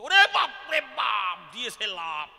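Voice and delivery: loud, drawn-out mock cry of alarm; shocked outcry. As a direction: cry out like a scandalized onlooker. Brahmins' shocked outcry acted out. (আঁতকে ওঠা চিৎকার)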